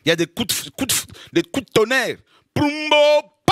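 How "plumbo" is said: high loud booming plumbo; mock thunder boom. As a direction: shout out the thunderclap. Imitated thunderclap.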